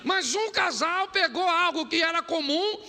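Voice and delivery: high, loud pulpit climax; full-force preaching. Real, not acted. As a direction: drive the point home to the congregation.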